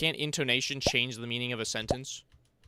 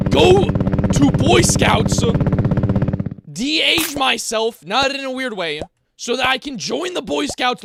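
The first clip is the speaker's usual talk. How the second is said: much higher, louder, slow comic rant; hammed-up rant; 11 semitones higher, 12 dB louder, slower than usual. Streamer spinning a comic rant to chat, loud mock rant. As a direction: keep the mock-despair tirade going.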